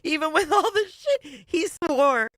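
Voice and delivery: laughing while reading it out; high laughing read. Real, not acted. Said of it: "I love it".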